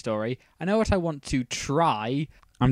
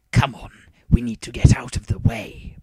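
urgent whisper; 7 dB louder than usual. A narrator's reading. Bakura voiced, whispered.

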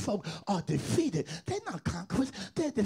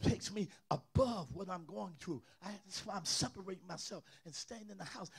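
quiet preaching; 11 dB quieter than usual. Real, not acted. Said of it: "I'm preaching in here today".

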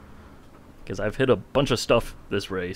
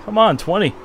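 yelling at a horse race; 15 dB louder than usual. Real, not acted. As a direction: cheer the car on loudly.

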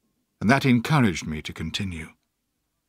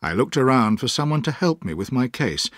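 plain reading voice

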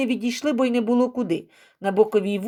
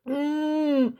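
high, closed-mouth moan; wordless groan; 7 semitones higher than usual. A narrator's reading. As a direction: groan through closed lips under the blows. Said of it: "тільки стогнав у відповідь".

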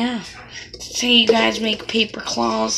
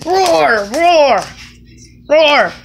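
loud monster roar; startling boo; 5 semitones higher, 10 dB louder, much slower than usual. Pretend. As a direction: roar to scare someone. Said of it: "Did I scare you?".

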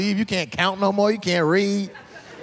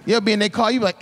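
ordinary delivery

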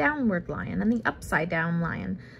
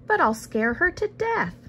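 lightly voiced fretting pupil, pitched up; worried child; 9 semitones higher than usual. A narrator's reading. Pupil protesting to the lion, mock-alarmed and high.

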